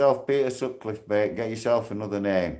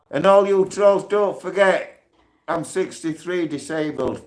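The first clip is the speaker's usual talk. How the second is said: calling out trolls; 6 semitones higher than usual. Real, not acted.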